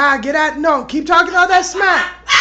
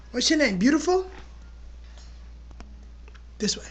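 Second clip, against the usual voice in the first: normal talking voice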